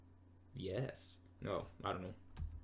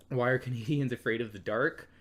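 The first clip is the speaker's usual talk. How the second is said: usual speaking voice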